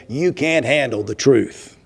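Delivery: gruff courtroom outburst, lightly imitated; a hint of a defiant witness on the stand; Jack Nicholson. Preacher quoting a film witness, a mild Nicholson impression. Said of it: "Jack Nicholson on the lawyer's stand saying".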